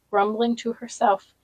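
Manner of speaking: usual speaking voice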